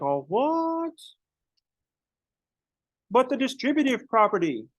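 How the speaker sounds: high, baffled whine of protest; indignant confused student. Retold or imitated. Student protesting to teacher, high baffled whine. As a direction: squeak it out like a wronged student.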